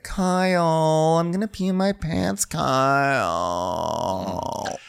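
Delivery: high, drawn-out mock whine; Amanda. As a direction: whine urgently, stretching the name. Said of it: "Amanda's like".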